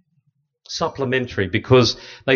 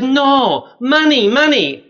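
put-on high pleading child's voice, much higher; begging little girl; 13 semitones higher, 6 dB louder, slower than usual. Little girl begs the priest for money, high pleading child. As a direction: play a street kid tugging at jeans, pleading. Girl insists after refusal.

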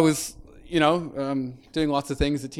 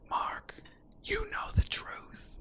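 slow, hushed whisper; little inner whisper; 15 dB quieter, slower than usual. Retold or imitated. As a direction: whisper it like a voice from inside. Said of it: "little voice inside of me whispering".

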